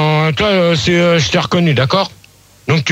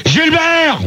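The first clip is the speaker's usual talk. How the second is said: high, exasperated shout of a name; real irritated summons; calling to order; 8 semitones higher than usual. Real, not acted. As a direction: call the troublemaker out sharply. Host calls unruly caller to order.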